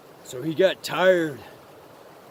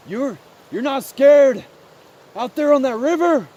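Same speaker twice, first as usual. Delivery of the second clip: higher, louder, amazed question; lightly voiced little mouse; 7 semitones higher, 7 dB louder than usual. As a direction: ask as an awed little mouse.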